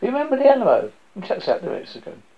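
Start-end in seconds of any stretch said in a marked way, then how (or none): 0.0-0.9 higher, louder patriotic shout; a big proud shout. A narrator's reading. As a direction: belt it out as a rallying cry. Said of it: "steps up and shouts".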